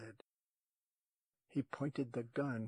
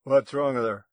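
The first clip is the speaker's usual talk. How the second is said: loud, sudden demand; gunman's loud challenge; demanding ranger; 14 dB louder than usual. A narrator's reading. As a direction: fire the question out loudly.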